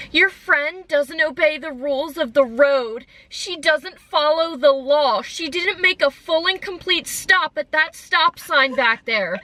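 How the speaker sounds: high, slow, drawn-out mock whine; super whiny. Mimicking the lady complaining about a friend's driving, super whiny. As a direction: whine it out slowly, imitating a petty complainer. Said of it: "in, like, this super whiny voice".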